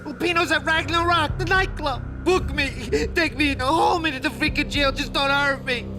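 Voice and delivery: high-pitched, panicky begging; frightened pleading. Acted. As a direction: give in and beg for mercy. Crook gives in, begs cop.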